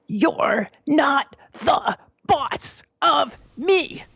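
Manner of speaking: put-on high, slow, drawn-out toddler protest; mock child's yell. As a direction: imitate a toddler's yell word by word, high-pitched without real volume. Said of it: "he yelled really loudly".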